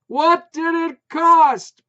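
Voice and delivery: high, loud, slow yell. A narrator's reading. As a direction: push it out harder. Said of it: "yelled louder".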